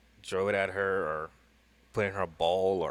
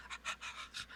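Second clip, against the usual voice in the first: quick breathy puppy pants; imitated panting, short voiceless bursts; dog panting; 15 dB quieter than usual. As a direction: pant like an excited dog. Imitates dog running up for treat.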